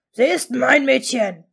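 loud, possessive snap; angry snarl. A narrator's reading. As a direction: lash out loudly at a rival. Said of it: "fauchte Ron".

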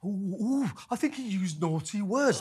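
high mock-shocked ooh; mock scandalised. Mocking the interviewer's outrage.

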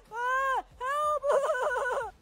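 scared yelling. Acted. Scared soulmate runs screaming.

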